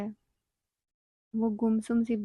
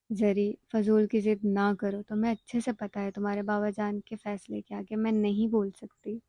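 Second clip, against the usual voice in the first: usual speaking voice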